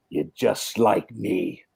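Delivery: slow, low, quietly menacing murmur; Duke's line. Retold or imitated. Producer pinning the actor to the wall, slow and low. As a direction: lean in and drawl it out slowly and softly. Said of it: "He goes".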